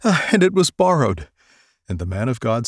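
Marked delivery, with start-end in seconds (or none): 0.0-1.3 high, loud anguished cry; cry of dismay. A narrator's reading. Woodcutter crying out to Elisha over the lost borrowed axe head, dismayed. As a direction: wail it out, stricken over the loss. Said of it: "he cried and said".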